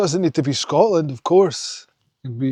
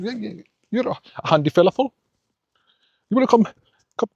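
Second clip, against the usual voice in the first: imitating a stuttering Englishman, pitched higher; strong English accent; 6 semitones higher, faster than usual. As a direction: put on an English accent and stumble over the words. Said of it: "in his strong English accent".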